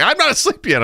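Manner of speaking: high, mock whiny wife. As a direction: complain you're still awake. Wife complaining.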